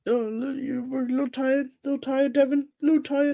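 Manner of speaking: mocking high-pitched little-tired voice; put-on high voice, poking fun at a friend; teasing imitation. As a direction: mimic the tired co-host in a high voice. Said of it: "the way you sounded like that was just funny".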